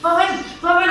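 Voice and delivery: acted giggling at a crying child; laughing mockingly. Shown as a demonstration. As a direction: snicker out the name in mock amusement. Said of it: "నవ్వుకుంటా పోయి". (నవ్వుతూ ఎగతాళిగా)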